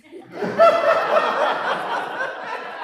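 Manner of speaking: loud high cackling laugh; real loud laughter; burst of laughter. Real, not acted. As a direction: laugh out hard at the joke. Big laugh at the joke.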